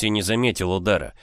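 usual speaking voice

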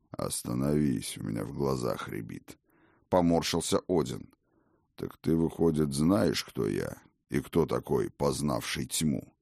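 put-on low, irritated old god; a low, wincing grumble; weary old king. Odin wincing.

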